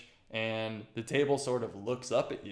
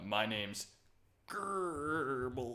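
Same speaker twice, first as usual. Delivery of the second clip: dwarf's odd name; 2 semitones higher, 4 dB quieter, much slower than usual. Acted. Dwarf introducing himself to Ear Talk, name cracking with laughter. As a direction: spit the name out, half laughing. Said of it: "he says it like that".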